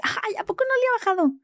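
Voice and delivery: high, sing-song mock of a careless nurse. Mocking the nurse's dismissive reply.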